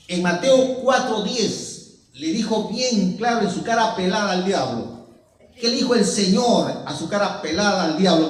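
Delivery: forceful preaching. Acted. Preacher urging brethren to follow the Lord, forceful preaching. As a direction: drive each question at the congregation.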